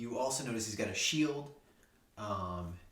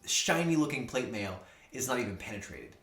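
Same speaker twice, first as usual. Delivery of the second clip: usual speaking voice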